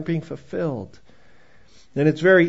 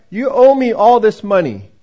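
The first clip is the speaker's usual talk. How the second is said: loud, stern demand for money; angry landlord at the door; landlord demanding rent; 4 semitones higher, 9 dB louder than usual. Retold or imitated. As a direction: bark the debt at her.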